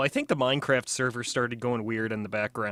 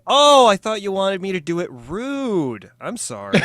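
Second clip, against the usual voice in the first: loud, high, drawn-out mock huffiness; pissy; 7 semitones higher, 9 dB louder than usual. Pretending to misunderstand a viewer's request, pissy. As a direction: act pissy, with an exaggerated slow retort. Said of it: "pissy with people and go".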